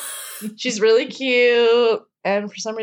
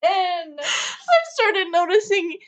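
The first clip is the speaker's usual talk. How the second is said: laughing; 8 semitones higher, much slower than usual. Real, not acted. Laughing while explaining.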